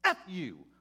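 put-on surly tough teen. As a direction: sneer it like a kid who hates being there. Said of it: "That was his mentality".

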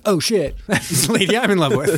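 high, startled mock gasp with a laugh; surprised. Captain sees his buddy's fiancée in the photo, mock shock.

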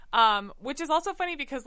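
usual speaking voice

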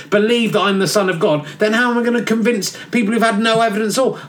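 normal talking voice

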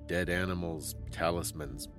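ordinary delivery

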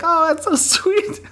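high, slow doting voice; wife cooing back at husband, hammed-up sweet; mock sweet reply.

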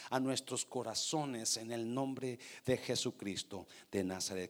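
low, soft praying voice; subdued, reverent prayer, well below preaching volume. Real, not acted. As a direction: lead the congregation in a hushed prayer. Leads the opening prayer.

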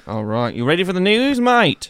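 a hint of Australian accent; lightly put-on Aussie. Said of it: "like doing the accent".

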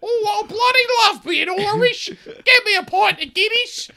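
very high, badly faked Irish accent sliding into Australian; squawky, over-the-top fake Irish accent. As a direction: ham up a Brit's hopeless attempt at Irish. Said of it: "that's him doing an Irish accent".

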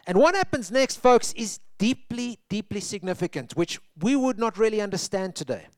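usual speaking voice

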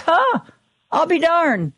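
high, amused "huh, I'll be darned"; surprised. Retold or imitated. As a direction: sound pleasantly surprised. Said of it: "I saw this graph and I was like".

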